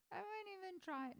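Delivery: usual speaking voice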